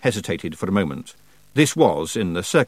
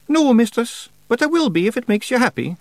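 high Scots pictsie, mildly careful; 4 dB louder than usual. A narrator's reading. Feegle placates the witch, careful. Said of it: "said Billy carefully".

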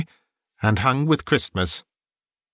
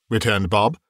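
usual speaking voice

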